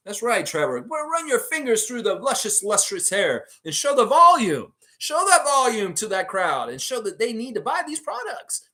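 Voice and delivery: high, loud showman's sales pitch; mock hype. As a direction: ham it up like a product demonstrator working a crowd. Mock hype of Trevor's hair demo.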